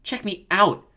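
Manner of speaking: high, loud echo of a brag; parroted boast. A narrator's reading. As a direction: parrot the show-off.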